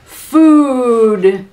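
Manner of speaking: a huge hungry bellow; giantess bellowing. Acted. Giantess grabbing the cow, bellowing with hunger. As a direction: boom it out like a greedy giantess. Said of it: "the giantess bellowed".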